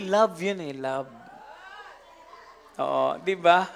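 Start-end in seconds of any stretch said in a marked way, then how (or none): none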